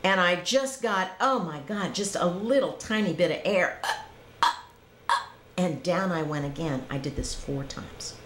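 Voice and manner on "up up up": choked gasps on each up; gasping for air. Retold or imitated. Surfacing from the river, mock choking gasps. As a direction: reenact failing to breathe in. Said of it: "like that".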